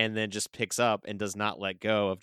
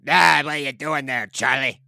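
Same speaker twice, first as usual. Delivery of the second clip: loud, higher-pitched killer snowman voice; Jack Frost impersonation; 4 semitones higher, 9 dB louder than usual. Acted. Co-host shows off his impersonation, loud villain voice. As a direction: belt it out as a cartoonish movie villain. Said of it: "as my Jack Frost impersonation".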